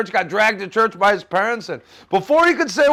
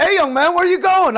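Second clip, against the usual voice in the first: annoyed call; 8 semitones higher, 3 dB louder than usual. Retold or imitated. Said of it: "I was a little annoyed at him".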